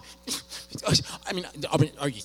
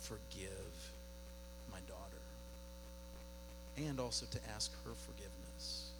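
much softer, hushed and earnest; quiet reflective preaching; 12 dB quieter than usual. Acted. Pastor softly tells the church how God convicts him. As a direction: let it drop to a hush to draw them in.

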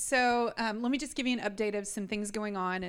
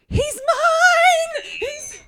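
high, very loud yell of he's mine; frantic scream; 15 dB louder, much slower than usual. Retold or imitated. Mother running to claim her son from strangers, screaming. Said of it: "I go running".

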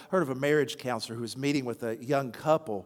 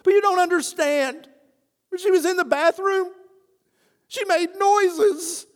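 anguished husband; 13 semitones higher, 8 dB louder than usual. Retold or imitated. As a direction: stretch out the embarrassing confession. Husband voiced in retold story.

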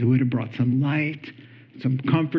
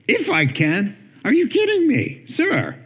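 incredulous retort; 9 semitones higher than usual. Retold or imitated. Jesus retorting to the doubting father, incredulous. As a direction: jump way up in pitch on "are you kidding me".

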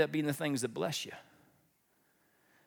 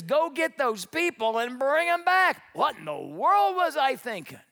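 high, louder acted command; Pharaoh; 7 semitones higher, 4 dB louder than usual. Pharaoh sending his army after the slaves, exasperated. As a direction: order it out with mock exasperation. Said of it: "So he says to this army".

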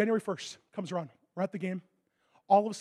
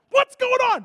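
much higher and louder, panicked; alarmed shout; 9 dB louder than usual. Retold or imitated. Medical staff yelling at the injured player skating, panicked. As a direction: yell them back off the ice.

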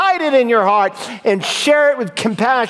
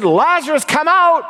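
high, commanding summons; preacher's re-enacted call to the dead; Jesus calling out; 7 semitones higher than usual. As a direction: climb in pitch as you call someone forth. Jesus calling Lazarus from the grave.

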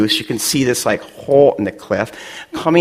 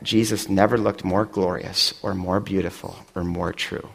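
softer, lower, fading to almost nothing; reverent hush; 5 semitones lower, 7 dB quieter than usual. Real, not acted. Pastor recalling Jesus in that dark moment, hushed and reverent. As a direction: let it fall away in awe.

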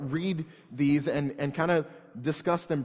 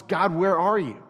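psalm plea; 4 semitones higher, 6 dB louder than usual. Acted. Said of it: "the next psalm is".

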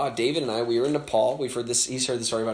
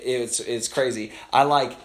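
usual speaking voice

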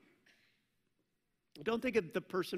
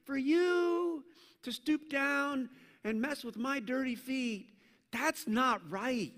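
imagined Peter; 7 semitones higher, slower than usual. Retold or imitated. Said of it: "I'm assuming Peter is like".

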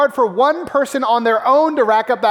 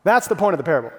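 ordinary talk, nothing marked

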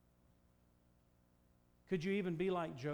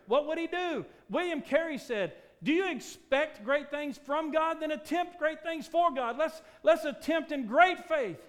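loud, high, ringing sermon voice; full-force preaching; 10 semitones higher, 12 dB louder than usual. Acted. Preacher rousing the church to great faith. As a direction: preach it out to the back row.

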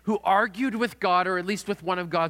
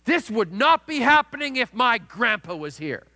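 a mock shout, higher and louder, like a defiant three-year-old; retold child's yell; 4 semitones higher, 6 dB louder than usual. A dad retelling his daughter yelling mid-argument, imitated loud shout. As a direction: hurl it back as a toddler at the end of her rope. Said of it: "by exclaiming at the top of her lungs".

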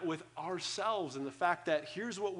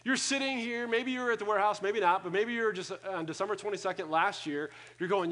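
ordinary talk, nothing marked

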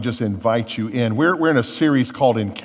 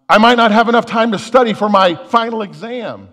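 higher, louder mock fretting; worked-up worrier; 8 semitones higher, 7 dB louder than usual. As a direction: act out getting worked up with worry. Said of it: "getting all worked up over something".

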